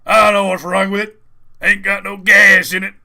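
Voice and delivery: loud, high mock holler; imitating video bystander. Friend quotes the man filming a helicopter crash, hollered imitation. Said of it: "there's the way he says it".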